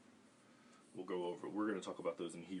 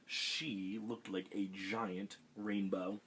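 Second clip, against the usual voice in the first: plain reading voice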